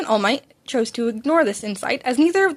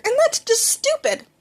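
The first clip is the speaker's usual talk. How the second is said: much higher, slow mocking voice; 14 semitones higher than usual. Bakugo mocking All Might's reasoning, high and slow. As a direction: sneer it out slowly.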